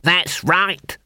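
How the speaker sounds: a mild call from the back; shouted. A narrator's reading. Dwarf calls from back of crowd, mild shout. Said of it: "shouted a dwarf".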